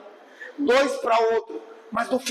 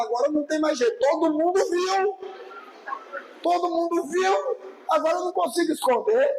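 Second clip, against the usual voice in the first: higher, slowed, anxious self-talk, the last words rushed; a shy believer voicing fear that everyone saw, pitched up and slowed; panicked embarrassment; 8 semitones higher than usual. Pastor imitating a shy believer's panic, pitched up and slowed. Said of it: "você fala".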